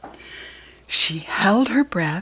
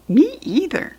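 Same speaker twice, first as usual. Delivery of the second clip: higher, lightly voiced small bird; little bird voice; 8 semitones higher than usual. One searching bird answering the other, high bird voice.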